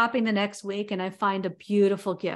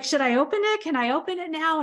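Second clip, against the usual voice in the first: high, eager pleading; husband impatient to unwrap the gift; 7 semitones higher than usual. Retold or imitated. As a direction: beg like a kid who can't wait to open it. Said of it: "And he's like".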